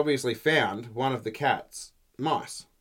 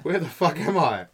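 higher, louder mock-panicked mouse; bewildered swallowed mouse; 6 semitones higher, 5 dB louder than usual. Live mouse lost inside the chook's stomach, loudly bewildered. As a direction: cry out, baffled, as the tiny mouse. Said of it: "in its stomach going".

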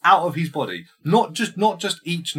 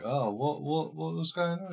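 softer, rising higher, lightly acted; mock concerned family; 6 semitones higher, 7 dB quieter than usual. Family talking in the kitchen, lightly acted concern. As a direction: ask it softly in a pretend voice.

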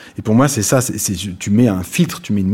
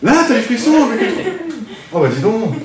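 laughing; 12 semitones higher than usual. Real, not acted. Amused reaction to student.

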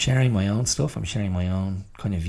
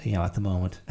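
ordinary talk, nothing marked

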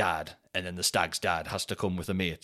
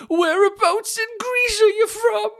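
much higher, louder and slower, a squeaky put-on voice; mock tourist voice; 10 dB louder, slower than usual. Strangers asking him where he's from, squeaky mock voice. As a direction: ham up the strangers' question in a silly high voice. People in front asking, mocked.

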